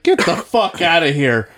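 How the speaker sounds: high, loud mock outrage; laughing disbelief. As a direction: scoff in disbelief. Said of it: "And I was like".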